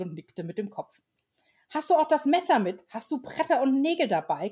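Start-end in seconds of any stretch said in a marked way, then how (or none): 1.7-4.5 put-on high, louder whiny child voices; high whining elf children. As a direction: pester him like impatient kids. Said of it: "quengelten die Wichtelkinder".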